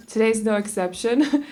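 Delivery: ordinary talk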